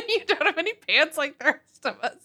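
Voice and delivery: high voice breaking up with laughter; helpless, high-pitched laughing delivery. Real, not acted. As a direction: squeeze the joke out through giggles. Cracking up while telling joke.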